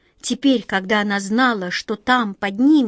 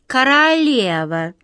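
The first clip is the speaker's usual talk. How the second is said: slow, high haughty correction; 4 semitones higher, 4 dB louder, slower than usual. A narrator's reading. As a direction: correct him with lofty pride. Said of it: "надменно поправила его Мышка".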